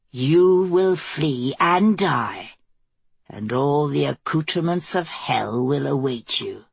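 slow, ominous prophecy sinking low; taunting dark creature. A narrator's reading. Dark thing warning the queen of hell, slow ominous taunt. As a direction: pronounce doom slowly, dropping into a low, knowing close.

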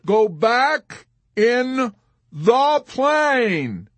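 very slow, high, drawn-out begging; pleading. A narrator's reading. Yossarian begging Arfi to leave, slow plea. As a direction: wail it word by word. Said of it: "pleading with him now".

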